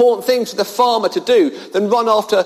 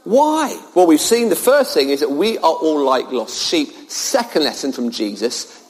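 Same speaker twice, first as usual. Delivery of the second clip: usual speaking voice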